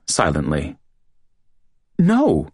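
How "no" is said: high, frightened denial; panicked. A narrator's reading. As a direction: blurt it out in panic.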